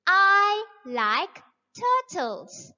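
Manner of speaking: slow, clear, every word spelled out; slow model reading. Shown as a demonstration. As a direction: read it slowly for young learners. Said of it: "You will say".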